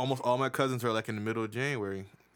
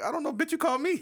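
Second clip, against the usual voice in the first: high, mock-exasperated comeback; mock exasperated retort; 4 dB louder than usual. Telling how he answered a confusing caller, high mock exasperation. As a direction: fire back high and incredulous. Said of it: "I was like".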